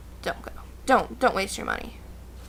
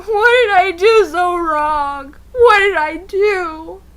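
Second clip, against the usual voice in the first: slow, higher, tearful lament; mock crying; 7 semitones higher, 8 dB louder, much slower than usual. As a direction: wail it through sobs. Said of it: "sitting there going".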